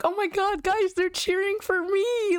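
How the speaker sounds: high-pitched, gushing delight; starstruck, high-pitched squeal of joy; giddy retold excitement. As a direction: gush as if the crowd adores you. Retold thrill at crowd cheering her.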